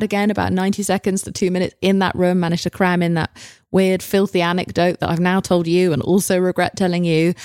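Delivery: normal talking voice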